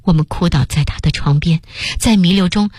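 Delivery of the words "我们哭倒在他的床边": usual speaking voice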